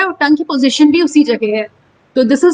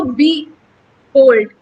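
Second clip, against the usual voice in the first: बी unstressed, then hold hit louder after a pause; light बी, pause, strong hold; stress on hold; 3 dB louder than usual. Shown as a demonstration. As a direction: split बी hold in two and punch hold. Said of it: "बी जो है वो unstressed है".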